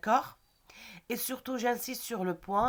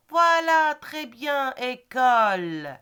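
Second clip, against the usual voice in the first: bright, loud approving "voilà"; enthusiastic praise; 4 semitones higher, 8 dB louder, slower than usual. Shown as a demonstration. Teacher loudly praising a pupil's correct answer. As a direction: cheer the pupil on for finding the word.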